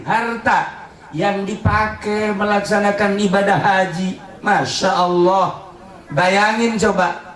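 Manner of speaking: high-pitched, ringing exhortation; fervent preaching. Real, not acted. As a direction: preach with full conviction, driving each phrase home. Preacher exhorts about hajj. (khotbah berapi-api)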